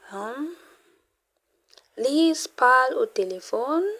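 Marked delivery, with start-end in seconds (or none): 1.9-3.9 Lise parle au téléphone with the pitch rising at the end; rising question tune. Shown as a demonstration. Teacher shows a question by tone alone, rising. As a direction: lift the tone at the end. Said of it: "you put your tone up".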